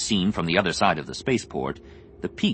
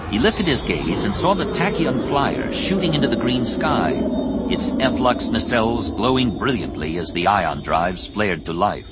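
plain reading voice

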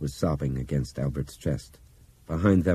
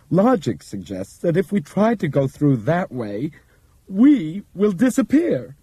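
higher, measured, careful character voice; Bob's precise voice; 13 semitones higher, 7 dB louder than usual. Acted. Bob addressing passengers over intercom, measured explaining voice. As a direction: explain it like a calm lecturer.

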